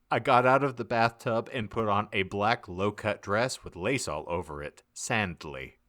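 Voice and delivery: usual speaking voice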